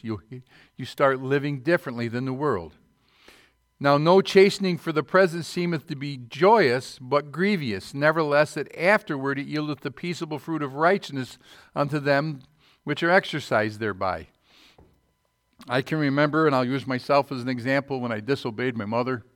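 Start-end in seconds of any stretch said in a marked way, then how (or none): 3.8-14.3 loud, raised preacher's reading; scripture reading. Acted. Preacher reading scripture to the church, loud and raised. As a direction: declaim the verse to the congregation.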